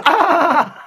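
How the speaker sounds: loud, high mock cackle; imitated fake laugh. Imitating Alex after being told be happy, mock laugh. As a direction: burst into an exaggerated laugh. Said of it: "He goes".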